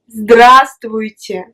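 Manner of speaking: здравствуйте said slowly, every consonant sounded; slow model word. Shown as a demonstration. A teacher slowly repeats здравствуйте for the student to echo. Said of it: "répète".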